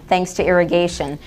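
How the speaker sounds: ordinary talk, nothing marked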